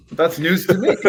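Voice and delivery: high, loud feigned surprise; a hammed-up, wide-eyed "oh really"; mock innocence. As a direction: play dumb, as if it's the first you've heard. Playing dumb at the gas station.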